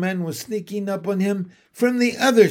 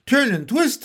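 high, loud goose command; excited, pitched-up shout; honked call; 8 semitones higher, 5 dB louder than usual. A narrator's reading. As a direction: honk it out urgently. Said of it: "honked the goose".

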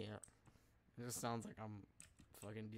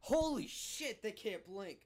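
shocked shout; 9 semitones higher, 4 dB louder, slower than usual. Real, not acted. Sudden alarm at the game.

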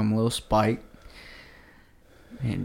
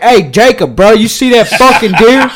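much louder mock full-voice call, laughing; loud demo; 11 semitones higher, 16 dB louder than usual. Shown as a demonstration. Showing friends why whispering fits, loud mock call. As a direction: belt it out to show how wrong it'd be. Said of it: "I wasn't like".

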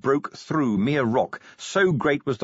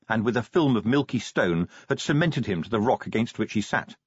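ordinary delivery

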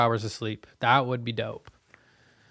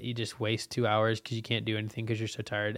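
ordinary delivery

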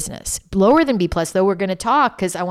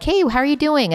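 sing-song check-in; 10 semitones higher than usual. Retold or imitated. Mother checking on moody daughter.

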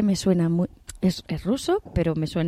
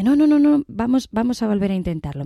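much higher and louder, frantic no no no; terrified farmer pleading with the bear; panicked pleading; 4 semitones higher, 4 dB louder than usual. Acted. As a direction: beg for your life in a rush. Farmer begs the angry bear.

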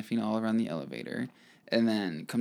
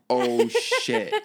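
dismayed; 6 dB louder than usual. Retold or imitated. Owners see dog left.

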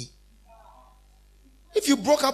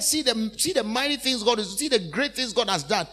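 ordinary delivery

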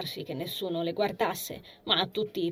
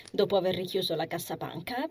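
ordinary delivery, nothing marked

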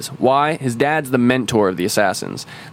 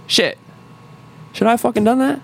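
much higher, hammed-up dawning regret; mock doubt; 11 semitones higher, faster than usual. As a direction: gasp it as the guilt sinks in. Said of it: "end up at the end kinda being like".

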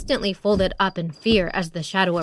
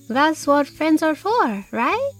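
warm friend; 6 semitones higher than usual. A narrator's reading. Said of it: "Beatriz added".

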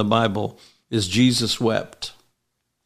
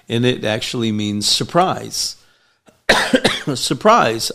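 normal talking voice

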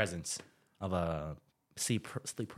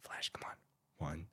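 slow, hushed whisper to himself; frozen in sleep paralysis, whispering himself awake; self-urging whisper; 6 semitones lower, 11 dB quieter, much slower than usual. Retold or imitated. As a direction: coax yourself awake under your breath. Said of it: "Then I was like".

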